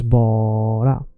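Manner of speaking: BARA with a long first syllable; first syllable stretched. Shown as a demonstration. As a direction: draw out the first vowel of "bara". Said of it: "first syllable stretched".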